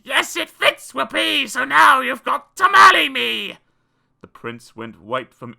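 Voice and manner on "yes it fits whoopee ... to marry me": high, loud triumphant screech; hammed-up victorious shriek; screamed. A narrator's reading. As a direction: scream it gleefully as the ugly sister. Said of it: "But she screamed".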